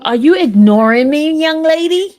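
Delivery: loud, high playful scolding; loud mock scold. Real, not acted. Scolding her daughter on the phone, playfully loud. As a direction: call her out loudly, half joking.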